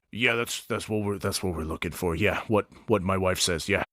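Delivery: put-on old orc praising his wife, deep and soft; old orc Stone. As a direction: rumble it like a doting old orc. Stone agrees.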